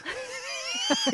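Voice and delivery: high, breathy little laugh; amused laugh. Real, not acted. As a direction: laugh it off lightly. Amused at her unconvincing sadness.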